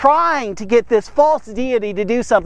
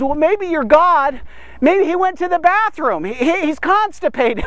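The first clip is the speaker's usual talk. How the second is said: mocking taunt; 6 semitones higher than usual. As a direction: needle the priests with mock concern. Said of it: "Elijah starts mocking them".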